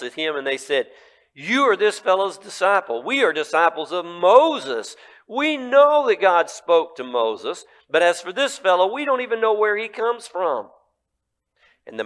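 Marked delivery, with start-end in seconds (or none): 1.3-10.7 insulting rulers. Acted. Rulers insulting the healed man, loud and high. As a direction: spit out the insults.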